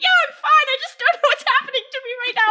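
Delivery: very high, loud, fast mock wail; retold hysterical bawling. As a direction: imitate a helpless meltdown between tears and laughter. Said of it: "pissing myself laughing as I'm bawling".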